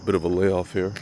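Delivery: ordinary talk, nothing marked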